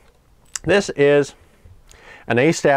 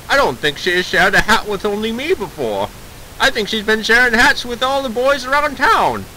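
mid-Atlantic voice climbing much higher; indignant old movie mid-Atlantic accent; 10 semitones higher than usual. Acted. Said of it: "whip out that mid-Atlantic accent".

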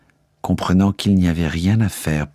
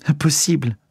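higher, ringing disbelief; disbelieving cry; 8 semitones higher than usual. A narrator's reading. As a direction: refuse to believe it aloud. Margot refuses the news. (refus incrédule, voix plus aiguë)

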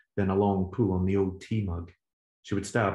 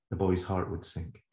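normal talking voice

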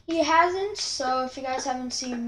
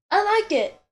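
excited; 10 semitones higher, 10 dB louder than usual. Real, not acted. Delighted with own drawing.

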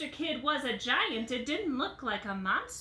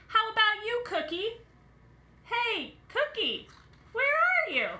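high, louder call for a missing friend; 11 semitones higher, 4 dB louder than usual. Acted. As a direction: shout across the kitchen for Cookie. Calling for missing Cookie.

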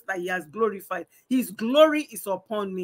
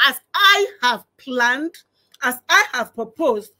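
loud, raised-pitch scripture quote; emphatic divine declaration; 3 semitones higher, 6 dB louder than usual. Acted. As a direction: proclaim God's decree with force. Quoting God's declaration again.

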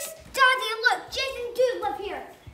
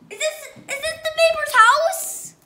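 alarmed; 7 semitones higher, 13 dB louder than usual. Real, not acted. Child alarmed in spooky house.

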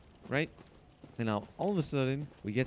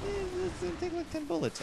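squeaky silly voice. Pretend. High silly voice estimating damage.

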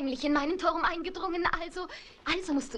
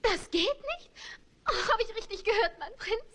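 indignant, shocked squeak; shocked disbelief; 6 semitones higher than usual. Acted. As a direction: pitch jumps up in dismay. Princess shocked by refusal.

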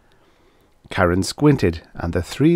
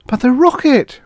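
very high, loud wail; 7 dB louder than usual. A narrator's reading. Karen sees the rocket leave, wailing. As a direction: cry out in high-pitched dismay. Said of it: "wailed Karen".

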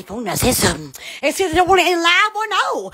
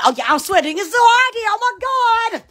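ordinary talk, nothing marked

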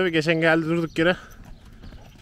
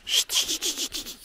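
shushing; slower than usual. Real, not acted. Hushing the restless horse.

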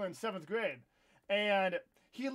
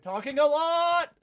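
self-mocking outburst; 7 semitones higher, 11 dB louder, slower than usual. Real, not acted.

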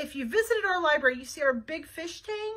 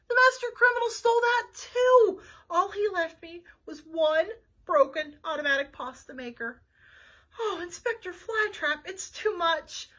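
higher and louder, slowing at the end; despairing chef; 4 semitones higher, 5 dB louder than usual. Acted. Chef lamenting to Flytrap he must close forever, despairing. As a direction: give up, heartbroken.

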